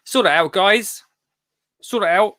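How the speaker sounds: a lighthearted singsong telling-off; mock plea. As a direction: tease them to sort it out. Teasing plea to the hosts.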